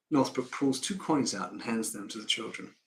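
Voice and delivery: ordinary talk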